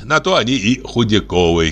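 ordinary delivery, nothing marked

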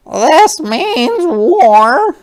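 high, slow, loud wail; drawn-out high-pitched wail; wailing Missus Pinch. A narrator's reading. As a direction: wail it out, stretching the words. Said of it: "wailed Missus Pinch".

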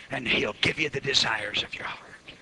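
breathy hush after the shout; whisper. Shown as a demonstration. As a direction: drop the line to a whisper. Verse dropped to a hush.

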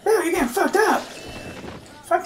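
alarmed yell. Real, not acted. Alarmed yell at NPC ally.

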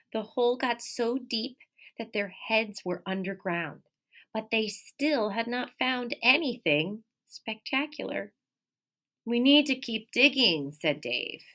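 lively, expressive read-aloud; animated picture-book reading; reading to children. Acted. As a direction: read it like storytime for little kids. Teacher reads picture book aloud.

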